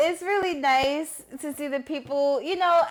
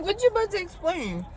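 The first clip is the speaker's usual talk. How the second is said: laughing; 6 semitones higher than usual. Retold or imitated. Laughing mock quote.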